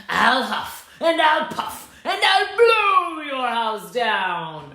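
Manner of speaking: slow drawn-out wolf threat; big bad wolf. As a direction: stretch every word like a huffing wolf.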